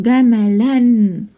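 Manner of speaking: slow "جملا" ending in a held -an; tanween fatha. Shown as a demonstration. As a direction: stretch "جملا" out as ga-ma-lannn. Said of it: "فتحتين من فوق". (تنوين بالفتح، "جملا" بتتنطق ببطء جَمَلَنّ)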